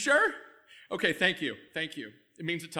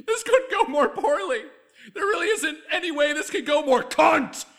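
hysterical panic; 7 semitones higher, 8 dB louder than usual. Acted. Spiralling panic after another slip.

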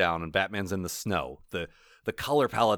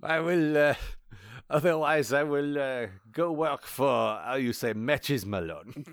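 put-on French accent; 3 semitones higher, slower than usual. Mock French Napoleon voice.

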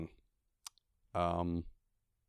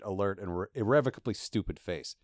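usual speaking voice

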